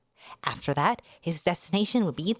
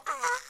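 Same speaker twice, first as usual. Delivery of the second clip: high startled yelp; 3 dB quieter than usual. Acted. Manga character exclaims.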